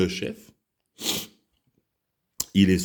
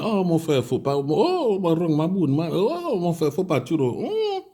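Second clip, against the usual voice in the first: supplication moqueuse; 9 semitones higher, slower than usual. Retold or imitated. Foule suppliant le mercenaire.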